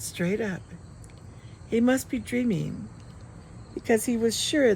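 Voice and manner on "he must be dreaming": ordinary delivery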